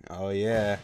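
usual speaking voice

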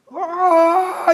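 grito de pánico imitado, muy agudo. Retold or imitated. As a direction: reproduce el alarido del sargento asustado. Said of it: "lanzó un grito". (sargento en la niebla, grito agudo imitado)

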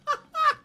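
very high, loud giggle; incredulous cackle. Real, not acted. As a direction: squeal with exasperated laughter. Laughs in disbelief.

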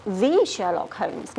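"the" drawn out as a long THEE; emphasis on "the". Shown as a demonstration. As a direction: stretch "the" slowly to mean the one and only.

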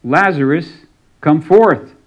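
commanding shout into the cave; loud cry. Acted. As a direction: call out loudly, rising on "come forth". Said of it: "he cried out with a loud voice".

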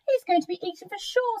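high-pitched iguana character. A narrator's reading. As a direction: predict doom in a high animal voice. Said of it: "said the iguana".

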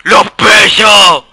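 slow, loud excited yell; excited shout. Real, not acted. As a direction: belt it out with glee. Excited yell at game character. (grito emocionado)

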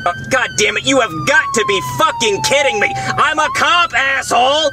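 angry cop voice. Acted. Cop voice, angry at being pulled over.

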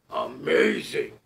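loud, slow, awed monster voice; a big beast impressed despite himself; Gruffalo voice. Acted. Gruffalo marvels at the mouse, slow booming awe.

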